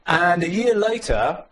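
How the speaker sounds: ordinary talk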